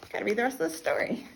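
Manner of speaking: ordinary delivery, nothing marked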